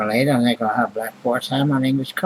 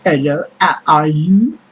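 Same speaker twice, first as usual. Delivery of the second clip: slow, loud, hammed-up French accent; mock the French with a slow, loud fake French accent; 3 semitones higher, 5 dB louder than usual. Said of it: "I can pretend I'm French".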